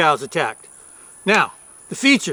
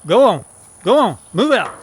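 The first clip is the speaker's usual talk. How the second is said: calling out to cattle. Real, not acted. Driving cows off.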